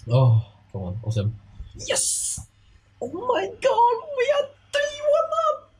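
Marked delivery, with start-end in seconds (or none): 3.0-5.7 high screaming cheer; goal celebration scream. Real, not acted. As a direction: scream it in disbelief.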